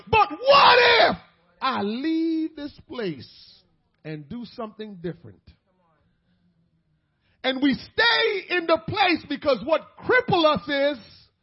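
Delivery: loud, high, shouted climax; full-force preaching. Acted. Preacher challenging the congregation, shouted and high. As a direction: build the sermon to a roar.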